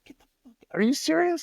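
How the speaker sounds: floored by the news; stunned disbelief. Retold or imitated. Hears Paul loves Gene jokes.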